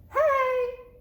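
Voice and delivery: very high, loud shout; roaring shout. A narrator's reading. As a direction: bellow it like a monster. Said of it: "the scariest monster roared".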